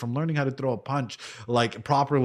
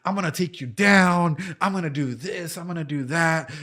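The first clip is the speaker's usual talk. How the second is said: slightly raised, imitated fighter bragging; mock cocky boasting; 5 semitones higher than usual. New fighter to his coach before sparring, mock cocky boasting. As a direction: reel off threats like an overeager sparring partner. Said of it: "That guy's just nonstop. You will, like".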